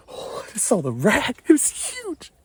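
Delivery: thrilled burst about the huge rack; excited. Real, not acted.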